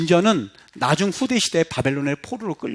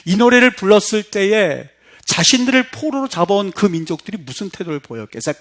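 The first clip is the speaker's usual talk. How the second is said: normal talking voice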